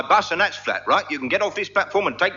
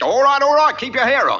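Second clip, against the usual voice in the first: louder and much higher, fed up; exasperated shout; 10 semitones higher, 6 dB louder than usual. Acted. Conductor giving in to the passenger, shouting. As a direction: bark it to shut him up.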